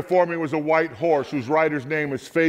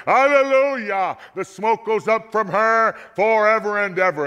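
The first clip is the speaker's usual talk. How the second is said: exultant shout; 7 semitones higher, 4 dB louder than usual. A narrator's reading. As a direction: shout it out as a triumphant crowd. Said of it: "they shouted".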